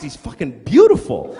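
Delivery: ordinary talk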